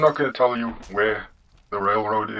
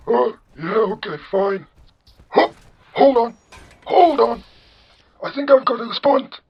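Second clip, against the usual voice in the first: put-on high old-man caricature; [name]; 12 semitones higher, 5 dB louder than usual. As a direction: halt them in an old-man falsetto. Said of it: "you have the generic old man voice".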